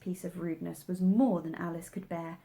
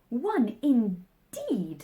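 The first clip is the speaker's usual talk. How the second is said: slow, louder, indignant; 3 semitones higher, 5 dB louder, much slower than usual. A narrator's reading. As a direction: stretch it out, offended. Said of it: "said the Dormouse indignantly".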